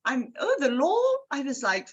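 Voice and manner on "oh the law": imitating posh. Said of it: "very impressed with the law".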